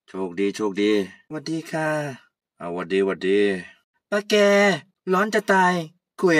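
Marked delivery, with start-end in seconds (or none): 2.6-3.4 put-on much lower, easygoing old man greeting; old uncle. Old uncle greeting Kaew, much lower. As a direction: drop low like a relaxed old uncle.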